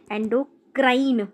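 "endocrine" drawn out very slowly, ending in "crine" and not "crime"; careful pronunciation. Shown as a demonstration. Teacher slowly shows students "endocrine" ends in "crine" and not "crime". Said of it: "क्राइम नहीं है, क्राइन".

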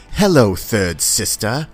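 slow, formal villain impression; Grand Inquisitor. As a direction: greet a fallen rival with measured menace. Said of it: "and he goes".

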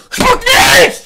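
high, loud protest; indignant yelling. Real, not acted. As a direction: yell in disgust at your mate. Objecting to being spat on. (empörtes Schreien)

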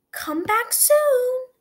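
high, slow, lilting giraffe. A narrator's reading.

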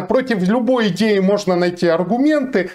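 ordinary talk, nothing marked

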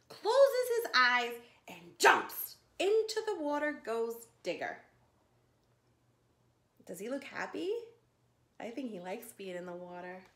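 lively storybook read-aloud for little ones; reading to children. Acted. Teacher reading a zoo story to children, lively storytime voice.

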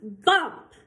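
a sharp loud Bump with a rise; sudden loud bump. Acted. Reading to kids, a loud startling bump. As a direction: make the reader jump.

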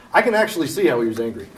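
ordinary talk

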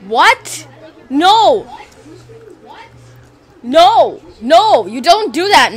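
loud, horrified no's at the screen; shocked gamer outrage; outraged shouting. Real, not acted. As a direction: yell at Max for what he did. Max kills his brother in game.

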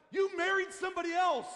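loud, high-pitched mock accusation; angry wife. Wife accuses husband over her dream, angry. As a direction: imitate a furious wife, hitting it hard. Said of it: "And she was angry at me".

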